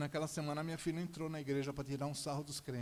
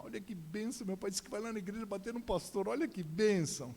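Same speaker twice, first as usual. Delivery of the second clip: high-pitched, excited phone gushing; gleeful daughter; 5 semitones higher than usual. Retold or imitated. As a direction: share the news in imitated delight.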